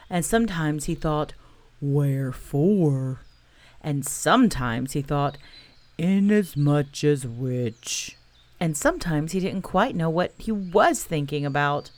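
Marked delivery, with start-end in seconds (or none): 1.8-8.2 low, slow gloomy donkey voice. Acted. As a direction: drag it out like a mopey old donkey. Eeyore's musing thoughts voiced.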